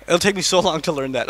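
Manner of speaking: ordinary talk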